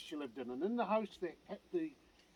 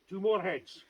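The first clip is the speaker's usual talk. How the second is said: usual speaking voice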